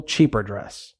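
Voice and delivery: usual speaking voice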